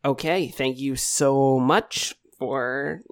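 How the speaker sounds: usual speaking voice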